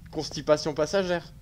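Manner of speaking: ordinary delivery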